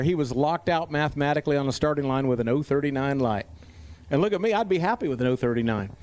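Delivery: usual speaking voice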